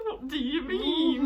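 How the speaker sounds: slow, loud laughing question; laughing disbelief. Retold or imitated. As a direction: laugh out the confusion.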